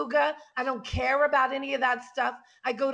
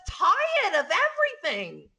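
mocking, stretched-out delivery; dragged-out speech; 7 semitones higher than usual. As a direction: drag each word out, imitating her. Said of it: "everything is so dragged out".

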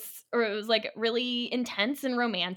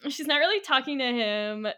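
usual speaking voice

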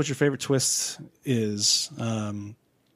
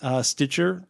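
ordinary talk

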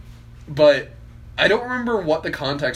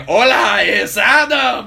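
loud, high, drawn-out mock greeting; teacher's booming reading; 7 semitones higher, 8 dB louder, slower than usual. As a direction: imitate a hammed-up Spanish textbook shout. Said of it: "that was how he said it".

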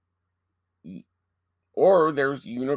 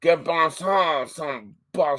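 mock French gibberish; 5 semitones higher, slower than usual. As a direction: fake French phrase in a hammed-up French accent. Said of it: "How would you say that in French".

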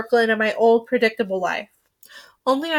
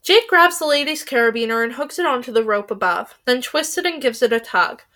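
ordinary delivery

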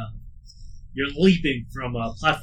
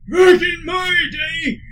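put-on cranky old man shout; 9 dB louder, much slower than usual. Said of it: "he's like".

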